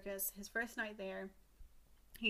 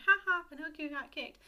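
higher, louder taunting "ha ha"; mocking laugh; 7 semitones higher, 8 dB louder than usual. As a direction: jeer at him like a schoolyard bully. Kids mocking Pinocchio.

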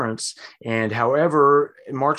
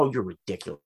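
scoffing; 5 semitones lower, 6 dB quieter, faster than usual. Retold or imitated. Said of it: "the guy's all".

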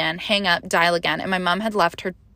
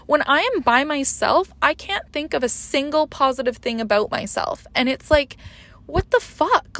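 high, strained voice close to tears; upset, tearful; 6 semitones higher than usual. Real, not acted. Mother recounting her breakdown and plea for help, upset and high-voiced. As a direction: speak higher, as if holding back tears.

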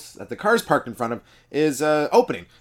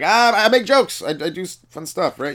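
sheepish; 7 semitones higher, 4 dB louder than usual. Retold or imitated. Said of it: "I'm like".

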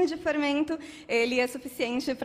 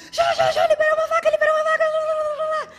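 gritaria aguda e atropelada da amiga; imitação de gritos; 9 dB louder than usual. Retold or imitated. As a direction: imite uma amiga berrando a notícia, bem agudo e alto. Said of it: "gritando assim". (gritando histericamente, em imitação)

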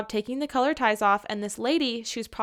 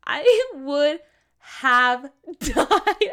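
higher and louder, breaking into a laugh; 4 semitones higher, 5 dB louder, much slower than usual. Real, not acted. Host imagining being the pierced patient, laughing through it. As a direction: try to say it but crack up. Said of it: "I should not be laughing".

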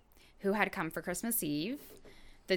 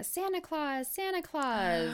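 high-pitched mock yell, no real volume; imitated excited squeal; 9 semitones higher than usual. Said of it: "squealed in excitement, yelling".

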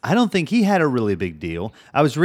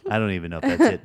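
usual speaking voice

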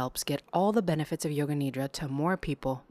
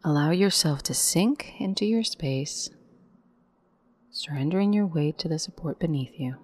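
guided meditation. Acted. Guide leading listeners into yoga nidra, calm meditation voice. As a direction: lead the breath in on a rise, let the exhale fall away.

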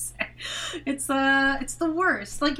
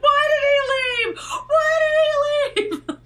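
mock sob; 6 dB louder, slower than usual. Snooki crying, retold.

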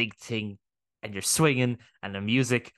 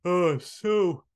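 talking through a yawn; words stretched by a yawn; 7 semitones higher, 4 dB louder than usual. Real, not acted. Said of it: "Sorry, I was yawning".

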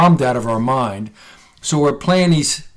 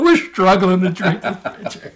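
laughing; 5 semitones higher than usual. Retold or imitated. Drunk laughing.

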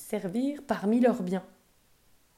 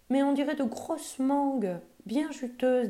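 put-on higher, eager child's protest; eager little girl; 7 semitones higher than usual. A narrator's reading. Polly argues with her mother, lightly voiced eager child. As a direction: insist like a child who wants it. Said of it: "dit Polly".

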